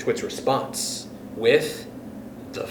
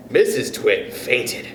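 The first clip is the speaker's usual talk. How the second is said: usual speaking voice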